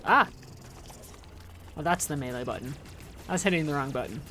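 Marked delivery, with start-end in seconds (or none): 0.1-0.3 short, loud, high "ah"; a quick yelp of surprise; startled yelp. Real, not acted. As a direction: caught off guard, one sharp cry. Startled during game play.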